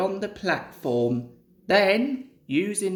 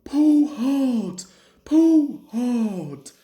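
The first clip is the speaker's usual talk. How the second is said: higher, louder puffing effort; puffed; 4 semitones higher, 6 dB louder, much slower than usual. Acted. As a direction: strain out each word like hauling a load. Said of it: "puffed Henry".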